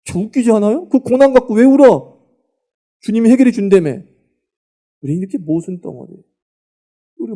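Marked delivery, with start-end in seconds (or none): 0.9-4.0 higher, louder sarcastic jab; sarcastic taunt; mocking question. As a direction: throw their own words back at them. Mocking hypocrites continued.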